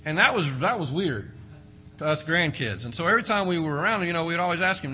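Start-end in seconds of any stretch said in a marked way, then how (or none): none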